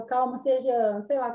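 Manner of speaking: normal talking voice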